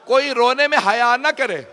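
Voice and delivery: high, loud pleading sermon cry; loud tearful appeal to the congregation; loud impassioned appeal. Real, not acted. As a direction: beg the mourners to weep openly. Preacher climax appeal.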